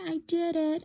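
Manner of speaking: proud little cheer. Retold or imitated. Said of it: "and I said".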